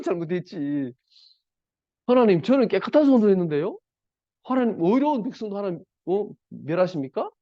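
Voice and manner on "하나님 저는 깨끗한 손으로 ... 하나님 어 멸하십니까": high, indignant protest to God; talking back. Retold or imitated. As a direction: protest your innocence, pitched up and pressing. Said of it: "라고 대들었습니다". (하나님께 대드는 아비멜렉, 높고 억울한 항변)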